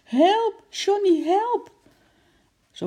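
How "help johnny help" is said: loud, high-pitched cry for help; screaming for help. A narrator's reading. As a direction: shriek it out in alarm. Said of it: "Hij gilde het uit". (luid en hoog om hulp gillen)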